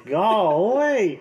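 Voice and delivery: high, loud cartoonish exclamation; cartoon golly. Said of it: "it's like some cartoon shit".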